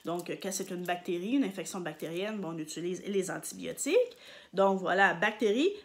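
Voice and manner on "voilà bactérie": usual speaking voice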